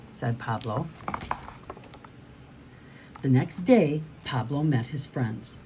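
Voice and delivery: plain reading voice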